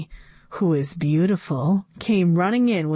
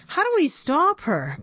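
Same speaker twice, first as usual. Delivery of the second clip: higher, urgent plea; cried out; 9 semitones higher than usual. A narrator's reading. Teacher cries out as the robot runs wild, high urgent. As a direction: beg for a way out. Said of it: "cried Missus Cooney".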